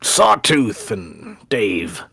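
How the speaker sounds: usual speaking voice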